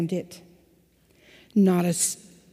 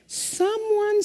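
a lightly squeaky, higher little mouse; 12 semitones higher than usual. A narrator's reading. Children's sermon storyteller voicing the mouse, lightly squeaky and higher. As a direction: pipe it up like a tiny mouse. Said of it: "And the mouse says".

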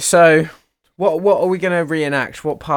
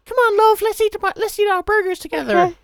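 high woman voice. Acted. Reenacted skit, woman character.

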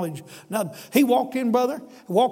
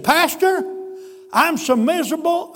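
higher and louder, pleading in anguish; miserable plea; 7 semitones higher, 3 dB louder than usual. Retold or imitated. A desperate father confesses his misery to the pastor, anguished. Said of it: "his old chin started quivering".

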